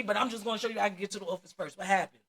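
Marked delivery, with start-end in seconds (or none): none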